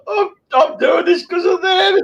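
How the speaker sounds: fighting through real sobs; crying. Real, not acted. Grandfather tearing up about his children, crying. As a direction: voice cracking up high with tears.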